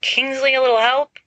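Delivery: high, loud plea; calling for help. A narrator's reading. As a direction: shout for rescue from the floor. Pinned under armor, calling for help.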